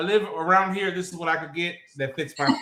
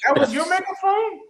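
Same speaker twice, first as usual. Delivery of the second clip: incredulous; 12 semitones higher than usual. Retold or imitated. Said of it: "I'm like".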